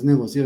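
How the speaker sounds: "negocio" wrongly said as "negocío"; broken diphthong. Shown as a demonstration. Teacher rejecting the error, "negocio" said as "negocío". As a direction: stress the i of "negocio", making "negocío". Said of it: "no hay un diptongo roto ahí".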